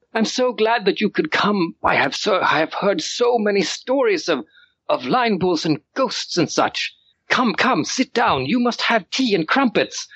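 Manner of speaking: put-on high, eager little boy rushing his welcome; excited child lord. Small lord greets the guests, high and thrilled. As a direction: gush like an overexcited kid meeting heroes. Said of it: "really excited the way kids do".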